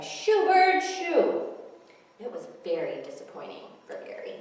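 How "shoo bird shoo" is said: high, loud shoo; kids shouting. A narrator's reading. Kids on the beach chasing the seagull, shouting. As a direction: shout at the gull to scram.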